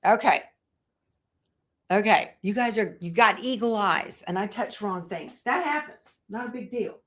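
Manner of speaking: usual speaking voice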